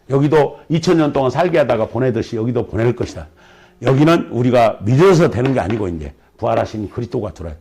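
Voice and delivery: normal talking voice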